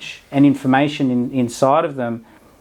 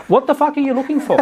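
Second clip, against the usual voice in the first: exasperated retort; 10 semitones higher, faster than usual. Acted. Mocking retort to self-finders.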